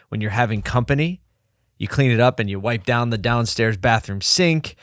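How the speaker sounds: ordinary delivery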